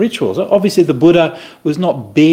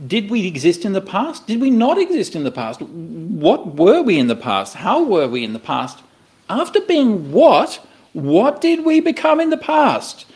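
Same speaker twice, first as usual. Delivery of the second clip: voiced wondering, pitch swooping high toward the end; acted pondering; 6 semitones higher than usual. Teacher voicing doubts about the past, wondering and high. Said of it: "would you turn back to the past thinking".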